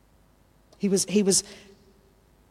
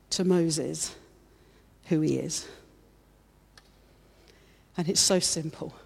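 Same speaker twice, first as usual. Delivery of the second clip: ordinary talk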